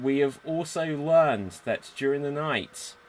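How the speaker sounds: ordinary talk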